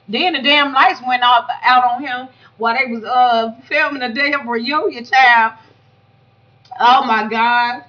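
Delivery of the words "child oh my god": ordinary talk, nothing marked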